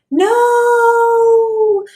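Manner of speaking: very high, loud squeal; mock-scared worm. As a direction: shriek like a cartoon victim. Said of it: "This is exactly what happened".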